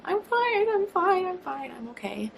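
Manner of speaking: high and loud, then dropping off; flustered insisting. Retold or imitated. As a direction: brush off the stranger, flustered. Insisting she's fine to stranger.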